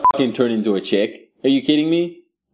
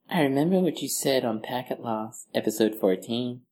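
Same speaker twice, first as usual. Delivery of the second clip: a much softer, menacing stalker voice; creepy stalker; 9 dB quieter than usual. Retold or imitated. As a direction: murmur it like a stalker in the background. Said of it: "they'd be like".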